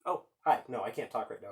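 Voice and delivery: usual speaking voice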